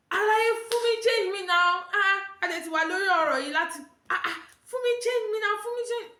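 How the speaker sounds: high-pitched insistent Yoruba nagging; heated demand. Retold or imitated. Passenger demanding her change from conductor, heated insistence.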